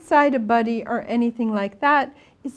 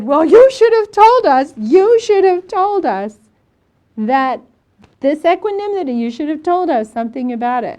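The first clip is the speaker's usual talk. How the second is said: loud, high reproach to the teacher; loud, emphatic complaint, hammering it home; indignant scolding; 5 semitones higher, 10 dB louder than usual. Retold or imitated. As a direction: confront them with raised voice and pitch. Student confronts teacher in retold quarrel.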